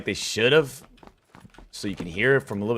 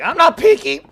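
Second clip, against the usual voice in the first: much higher, loud mock squeal; 11 dB louder than usual. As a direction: squeak it like a scared enemy. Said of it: "He's like".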